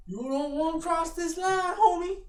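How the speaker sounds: high, loud gangster taunt; gangster threat. Pretend. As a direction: threaten him like a street tough. Gangster turf bit, shouting.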